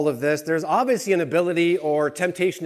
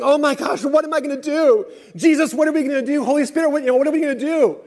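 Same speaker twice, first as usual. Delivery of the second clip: high, loud hammed-up fretting; mock-frantic God wringing his hands; mock panic; 12 semitones higher, 6 dB louder, faster than usual. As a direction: play a flustered worrier for laughs. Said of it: "wringing his hands".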